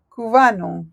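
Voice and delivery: usual speaking voice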